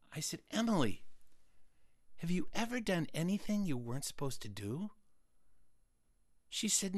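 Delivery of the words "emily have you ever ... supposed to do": soft, higher-pitched and earnest; hushed, serious question. Acted. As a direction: drop to a hush to ask gravely. Solemn hushed question after panic.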